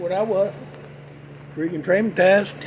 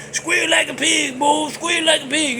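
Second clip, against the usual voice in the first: mock movie villain; 5 semitones higher than usual.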